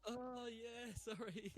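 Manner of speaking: usual speaking voice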